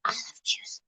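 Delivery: breathy whisper, almost no voice. Real, not acted. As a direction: whisper it to yourself. Whispered then repeated aloud.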